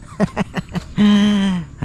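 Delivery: high burst of real laughter; hearty giggle. Real, not acted. Laughing after a shoutout to Kuya Dan, high giggle. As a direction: break into a high, rapid giggle.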